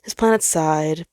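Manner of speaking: plain reading voice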